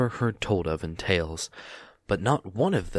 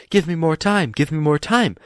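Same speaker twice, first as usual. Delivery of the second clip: higher, louder desperate plea; panicked shout; 8 semitones higher, 6 dB louder than usual. A narrator's reading. Bilbo cries out to Gollum in panic. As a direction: beg for more time. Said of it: "He wanted to shout".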